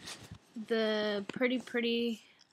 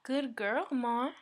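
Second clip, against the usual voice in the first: ordinary talk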